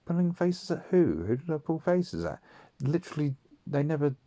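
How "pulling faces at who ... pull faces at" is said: high indignant disbelief; outraged who-me question; mock outrage, pitched up; incredulous. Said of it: "and I'd be like".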